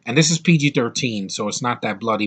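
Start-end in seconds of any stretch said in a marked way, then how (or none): none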